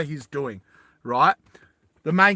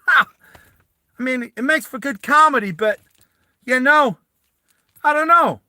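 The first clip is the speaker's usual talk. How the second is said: high, loud New Yorker; New York accent; 10 semitones higher, 7 dB louder than usual. Shown as a demonstration. Teammate complains before they've even landed, high New York accent. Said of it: "I'll see if I can do New York accent".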